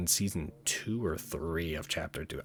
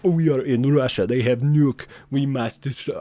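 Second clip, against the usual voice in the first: hammed-up Russian accent, higher and louder; mock Russian accent; 6 semitones higher, 6 dB louder than usual. Mocking shooter-game villains in a Russian accent. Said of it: "I said that in a Russian accent".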